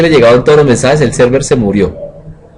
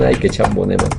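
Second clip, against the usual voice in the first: quiet, scoffing "qué chambón"; mock disappointed students; 6 semitones lower, 8 dB quieter than usual. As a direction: sigh it out like an unimpressed class. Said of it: "Ustedes dicen". (burla suave y baja de decepción)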